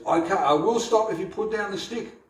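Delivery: put-on a little boy striking a deal with his mother; child Krishna bargaining. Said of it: "So he says".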